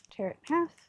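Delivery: jumping on a hiccup. Real, not acted. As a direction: voice hitched by hiccups. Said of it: "I got the hiccups".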